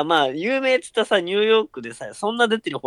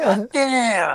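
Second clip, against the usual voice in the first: denying suspect; 8 semitones higher than usual. Retold or imitated. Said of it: "職質受けてさ".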